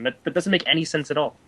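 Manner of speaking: ordinary delivery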